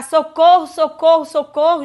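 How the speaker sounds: calling for help across the dark bus; retold cries for help. Mother on the night bus screaming for help, loud and rising. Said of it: "comecei a gritar".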